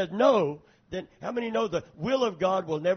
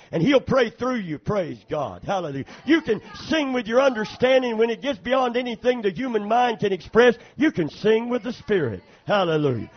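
preaching; 5 dB louder than usual. Acted. Preacher building to full force.

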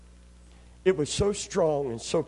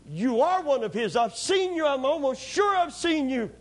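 higher, insistent accuser; accusing servant; 7 semitones higher than usual. Acted. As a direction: point the finger at him.

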